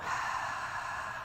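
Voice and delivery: quiet, breathy sigh; letting breath out. Shown as a demonstration. Yoga teacher letting the breath out with students, quiet sigh. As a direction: release a soft, audible exhale. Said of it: "let it out".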